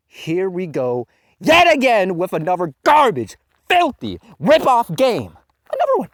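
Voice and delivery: a gamer's disgusted outburst over another rip-off; outraged rant. Real, not acted. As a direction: spit out the insult as it climbs. Ranting about a rip-off game.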